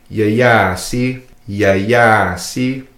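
"ya ya si" with the second "ya" held long; slow "ya ya si", long middle vowel; dragged vowel. Shown as a demonstration. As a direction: drag out the second "ya" in "ya ya si". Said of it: "you could hear how it's dragged".

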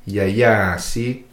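"Ya ya si" slow, the second ya stretched; dragged vowel. Shown as a demonstration. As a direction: drag the middle vowel of "Ya ya si". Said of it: "hear how it's dragged".

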